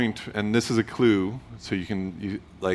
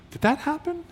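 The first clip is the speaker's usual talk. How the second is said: reader's disbelief. Retold or imitated. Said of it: "I want you to go".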